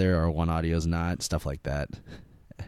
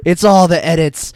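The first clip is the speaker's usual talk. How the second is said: louder insisting; 9 semitones higher, 13 dB louder than usual. Real, not acted.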